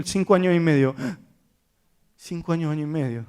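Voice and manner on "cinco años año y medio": quiet, taken-aback repeat; pensive echo. Retold or imitated. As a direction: softly echo the age to yourself. Therapist echoes the age after gasp.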